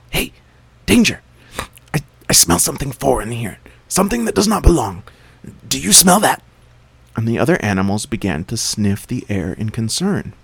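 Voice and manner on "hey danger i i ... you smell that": high, loud alarmed shout; jackal shouting. A narrator's reading. Jackal warning the servants of a foreign smell, loud and alarmed. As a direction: raise the alarm, sniffing danger. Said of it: "heard the jackal shout".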